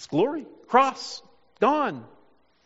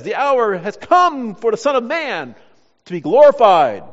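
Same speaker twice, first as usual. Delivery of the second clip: triumphant proclamation; 9 dB louder than usual. Acted. Preacher quotes Jesus announcing his hour, in a loud triumphant proclamation. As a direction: proclaim it boldly, louder as it builds.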